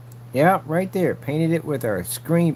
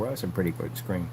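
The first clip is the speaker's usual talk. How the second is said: normal talking voice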